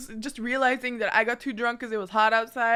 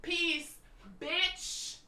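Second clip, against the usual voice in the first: dismissive peace out; 4 semitones higher, 11 dB quieter than usual. Retold or imitated. Leaving, brushing him off.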